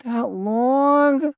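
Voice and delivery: put-on high, rising child's whine; a little boy about to cry; dismayed little boy. A narrator's reading.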